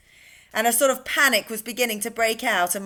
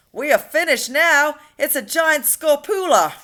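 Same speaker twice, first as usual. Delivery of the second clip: higher, louder panicked yell; 6 semitones higher, 7 dB louder than usual. A narrator's reading. Police chief yelling in panic at the peach creatures. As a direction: cry it out as doom arrives. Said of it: "yelled the head of police department".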